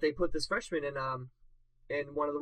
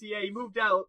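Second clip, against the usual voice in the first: ordinary talk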